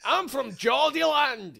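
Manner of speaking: put-on teasing friend with a slow Geordie accent. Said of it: "Your best impression of a Carlilian?".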